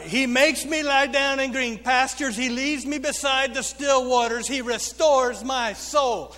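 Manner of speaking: loud, sustained psalm reading; loud scripture proclamation. Acted. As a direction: proclaim each verse to a responding congregation. Recites psalm for congregational response.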